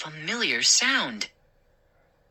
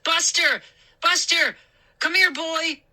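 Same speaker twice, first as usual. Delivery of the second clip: shouting; 12 semitones higher than usual. Acted. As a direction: call the dog up at the window. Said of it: "started shouting up to the window".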